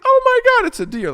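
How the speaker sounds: high squeal of delight; imitating excited girlfriend. Girlfriend spotting deer at night, high excited squeal. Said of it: "like, really excited".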